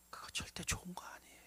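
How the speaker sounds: very soft, hushed warning; whisper. Acted. Preacher whispering a warning to the congregation. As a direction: breathe the warning almost silently.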